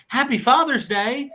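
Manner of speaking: put-on high sweet kid voice, lifting at the end; a child wishing the owner happy Father's Day; imitating kid. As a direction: chirp it like a little child. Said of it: "The kid goes".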